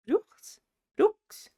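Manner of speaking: high, questioning one-word tries; unsure attempt. Real, not acted. Reviewer trying out the family's unfamiliar title, unsure and questioning. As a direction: try the word as a question, pitch jumping up.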